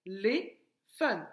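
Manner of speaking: "Téléphone" stretched slowly té-lé-phone; slow syllables. Shown as a demonstration. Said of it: "Frappe, téléphone".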